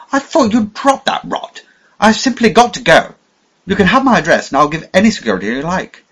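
put-on high, loud insistent young voice; agitated young man. A narrator's reading. Young man insisting he must leave for town, agitated and loud. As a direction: push hard to be allowed to go. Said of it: "said the young man".

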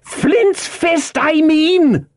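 very high, cursing yell; exasperated cry. A narrator's reading. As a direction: shout it in angry impatience. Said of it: "he cried".

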